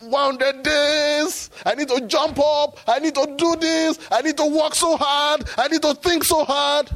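mocking his own anxious striving; mocking his old self. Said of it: "I was thinking".

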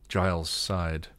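usual speaking voice

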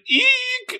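sudden very high fangirl squeal. Real, not acted. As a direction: squeal with delight at the landing.

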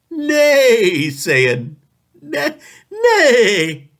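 high, loud whinnying nay; horse neigh. Acted. Jokes about horse.

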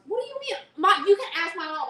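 high, loud laughing protest. Real, not acted.

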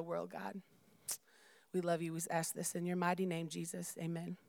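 soft, earnest spoken prayer. Real, not acted. Preacher asking God to be present before the reading, soft prayer. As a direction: pray quietly, letting the endings drop away.

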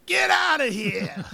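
gruff cop yelling get out; 1930s beat cop. Retold or imitated. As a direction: bark it like a tough cop. Teachers voiced kicking grads out.

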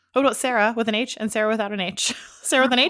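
laughing through Sarah with an H, loud. Real, not acted. Friends joking about name spellings, laughing. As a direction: giggle as you ask about Sarah with an H.

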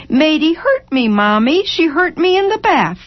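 put-on high, singsong little-kid voice; cheerful small child. A narrator's reading. Small boy telling on his sister to Mama, cheerfully. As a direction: tattle brightly in a high child's pitch. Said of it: "that old Jimmy having to say cheerfully".

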